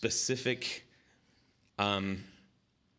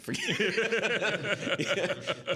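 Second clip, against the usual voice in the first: high laughing squeal; 12 semitones higher, much slower than usual. Real, not acted. Laughing at his own slip.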